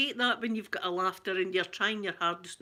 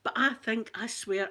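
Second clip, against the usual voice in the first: ordinary delivery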